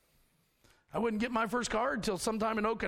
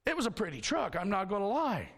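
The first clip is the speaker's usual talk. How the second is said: normal talking voice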